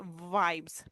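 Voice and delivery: वाइब्स with a held buzzing 'vvv'; vibrating V. Shown as a demonstration. Teacher showing lip vibration, buzzed वाइब्स. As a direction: lips buzz at the start of वाइब्स. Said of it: "vibration हो lips".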